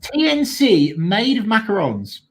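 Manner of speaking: showy presenter's proclamation; grand announcement. Acted. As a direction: announce it like a headline prize, drawn out. Reads out the teased tweet grandly.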